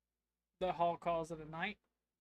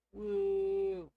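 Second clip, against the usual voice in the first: cheer; 3 semitones higher, 7 dB louder than usual. Real, not acted. Cheering on finding a kid.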